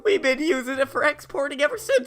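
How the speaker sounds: high, loud, laughing panic; terrified henchman squealing through laughter; panicked thug. Acted.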